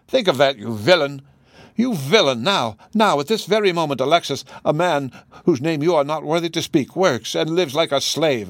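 indignant accusation. Acted. Wife calling her husband a villain, acted indignation. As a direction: accuse him with rising indignation.

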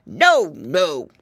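loud growling refusal; growlier wolf. A narrator's reading. Wolf turns down the pig mother's plea, growling. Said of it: "answered the wolf more growlier".

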